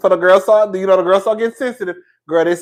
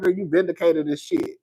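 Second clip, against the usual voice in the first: normal talking voice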